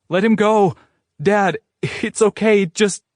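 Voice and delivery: high, loud frantic pleading; pleading shout. A narrator's reading. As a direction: beg her father to let go. Girl pleads with father.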